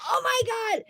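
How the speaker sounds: very high, startled delight; thrilled. Retold or imitated. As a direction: gasp it as the sleigh lifts. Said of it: "and I was like".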